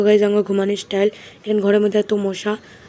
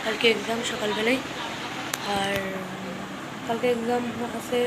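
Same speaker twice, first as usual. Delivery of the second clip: speaking softly; 9 dB quieter than usual. Real, not acted. Student vlogging while mom is on the phone, speaking softly. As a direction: keep it down so nearby phone talk isn't disturbed. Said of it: "আমি এত আস্তে কথা বলতেছি".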